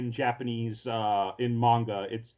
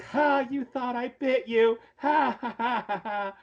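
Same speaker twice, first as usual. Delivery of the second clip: high, teasing gotcha laugh, drawn out; playful mischievous taunt, laughing high; mocking laugh; 12 semitones higher, slower than usual. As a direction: gloat that you fooled him, cackling. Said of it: "with this kind of a sly grin".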